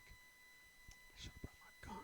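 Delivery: slow, breathless whisper; mock whisper. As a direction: confide it like a secret. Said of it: "being in the car like".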